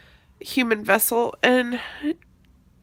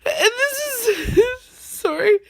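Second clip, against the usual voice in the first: high, tearful sobbing voice; choking up through tears; blubbering; 10 semitones higher, 6 dB louder, much slower than usual. Real, not acted. Sobbing while starting to speak.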